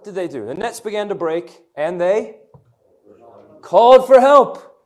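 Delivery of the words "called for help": called out high, loud and slow; calling for help, loud and stretched. Acted. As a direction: cry out for help, drawn out. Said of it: "You're with me".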